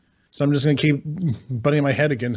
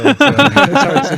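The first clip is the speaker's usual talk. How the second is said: laughing; 12 semitones higher, 9 dB louder than usual. Real, not acted. Laughing at awkward question.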